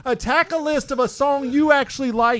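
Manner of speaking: mock outrage. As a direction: overplay the offended friend.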